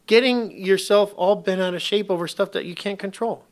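usual speaking voice

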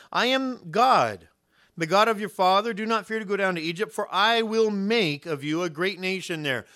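loud scriptural proclamation, leaning on key words; God's proclamation. Acted. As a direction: proclaim it as God's promise from the pulpit. Reads God's promise to Jacob.

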